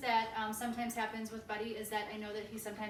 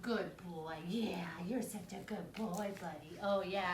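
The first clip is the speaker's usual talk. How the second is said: whispered, lower, slow praise; soft whispered praise; 4 semitones lower, slower than usual. Shown as a demonstration. Trainer reconciling after correction, soft and whispered. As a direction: hush your voice and soothe him. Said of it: "We totally change our voice".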